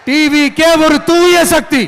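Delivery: high, slow rally slogan; slogan proclamation. Real, not acted. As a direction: proclaim it slowly to the crowd. (உரத்த, நிதானமான அரசியல் முழக்கம்)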